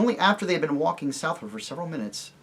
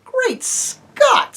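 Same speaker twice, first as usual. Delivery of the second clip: much higher, louder exclamation; astonished cry; 8 dB louder than usual. Acted. Eustace reacts to Jill's daring, astonished and loud. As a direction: burst out in amazement.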